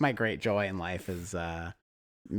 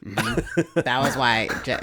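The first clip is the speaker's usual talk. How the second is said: normal talking voice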